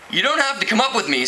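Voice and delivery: quickly. Acted. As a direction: hurry it out before he can follow. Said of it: "Screech said quickly".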